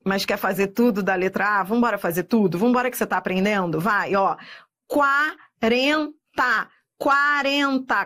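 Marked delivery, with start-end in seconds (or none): 4.9-8.0 syllable by syllable. Shown as a demonstration. Teacher going through quarenta for students, syllable by syllable. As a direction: separate quarenta into deliberate syllables.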